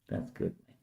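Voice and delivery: a soft unvoiced whisper. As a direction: breathe it out with no voice. Repeated praise, whispered.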